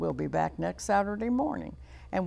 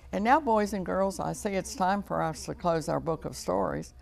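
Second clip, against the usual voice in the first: ordinary delivery, nothing marked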